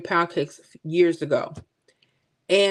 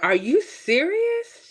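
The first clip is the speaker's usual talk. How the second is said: stunned disbelief; 11 semitones higher, slower than usual. Retold or imitated. Shocked at sister's praise.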